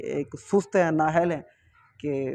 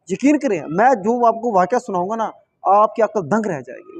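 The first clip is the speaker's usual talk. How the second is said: excited build-up; 4 semitones higher, 5 dB louder than usual. Real, not acted. Hyping up the shocking story.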